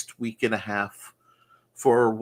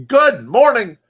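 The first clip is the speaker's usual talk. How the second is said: loud greeting; 11 semitones higher, 13 dB louder than usual. Real, not acted.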